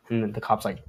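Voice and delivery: usual speaking voice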